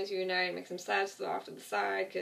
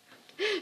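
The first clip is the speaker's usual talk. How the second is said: short, high sheepish laugh; embarrassed laugh; 12 semitones higher, 3 dB louder than usual. Real, not acted. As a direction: laugh at yourself for rambling. Embarrassed at how long she talked.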